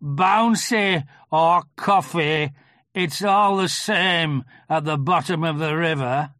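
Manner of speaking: slow, loud, aggrieved donkey; Eeyore. A narrator's reading. Eeyore explaining his fall in the river, slow and aggrieved. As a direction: lay out the grievance ponderously. Narrator voices Eeyore retorting.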